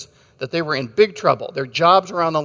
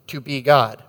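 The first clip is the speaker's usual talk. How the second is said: normal talking voice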